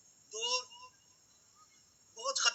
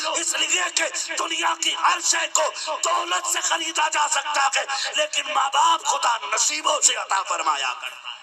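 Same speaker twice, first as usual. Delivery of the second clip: impassioned sermon building to loud peaks over music; full-force pulpit preaching about a mother's sacrifice; fiery preaching; 9 semitones higher, 6 dB louder than usual. Acted. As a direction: preach it hard to the young men. Preacher addresses youth about mothers.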